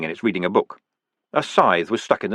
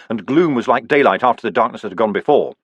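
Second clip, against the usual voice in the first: plain reading voice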